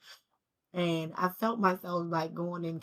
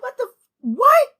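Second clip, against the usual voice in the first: cracking high shout, far louder; angry disbelief; 16 dB louder than usual. Retold or imitated. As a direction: shriek the shock out. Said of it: "I'm even more angry now".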